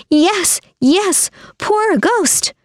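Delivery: very high-pitched woman in the crowd; female voice. A narrator's reading. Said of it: "a female voice said".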